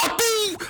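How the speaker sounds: very high startling bellow; a sudden shout that makes everyone jump; explosive general's roar; thunderous roar. Acted. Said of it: "把大伙儿吓了一哆嗦".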